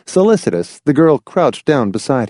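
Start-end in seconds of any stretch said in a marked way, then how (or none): none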